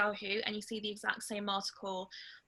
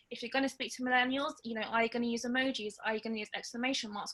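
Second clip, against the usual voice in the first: normal talking voice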